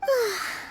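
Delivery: long breath out once they've gone; tired exhale, the pressure finally off; relieved sigh. Acted. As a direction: sigh of relief after a crowd. After the crowd disperses.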